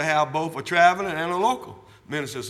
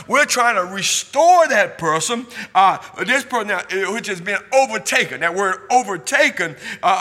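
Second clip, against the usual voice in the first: louder, higher preaching that swells into shouts; full-force preaching; 4 semitones higher, 9 dB louder than usual. Acted. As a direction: preach it out to the congregation, building hard.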